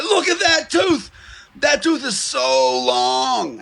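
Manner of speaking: high, slow marveling voice; amazed patient. Retold or imitated. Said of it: "And he was like".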